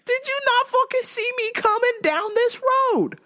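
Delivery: high, outraged demand; furious disbelief. Retold or imitated. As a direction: yell it at the driver in disbelief. Yelling at truck driver.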